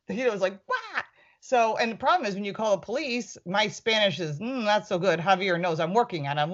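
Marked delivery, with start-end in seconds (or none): 0.7-1.0 imitating a shocked friend, much higher and softer; a light retold gasp of disbelief; mock shocked what. Friend retells her colleague's shocked reaction, mock surprised. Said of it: "Then he flipped out".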